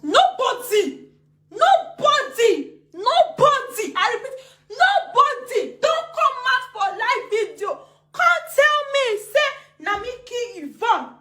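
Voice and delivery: high, loud, slow and insistent; emphatic denial. Real, not acted. Denying anyone accused her, loud and high. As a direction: drag out each nobody.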